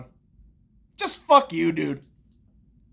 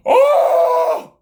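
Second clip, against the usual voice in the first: high, loud exclamation; loud startled yell; sudden yell; 7 dB louder than usual. Real, not acted. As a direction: burst out with a cry. Sudden outburst in rant.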